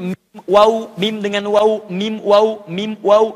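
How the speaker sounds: "mim, waw" as a tajwid drill; letters mim and waw. Shown as a demonstration. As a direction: say "mim" lips closed, "waw" lips rounded and apart. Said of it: "ada yang di bibir".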